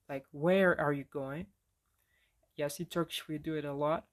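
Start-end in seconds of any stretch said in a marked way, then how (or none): 0.3-1.5 "Where" punched, then the rest eases off; stress on WHERE. Shown as a demonstration. Teacher showing how Turkish people ask, heavy stress on "Where". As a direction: hit the word "Where" hard. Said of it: "Turkish people stress W, W H words".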